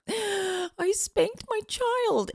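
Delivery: high, slow, breathless horror at herself; horrified gasp. Retold or imitated. Mother horrified after spanking her child, high and slow. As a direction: gasp it out, appalled at what she did.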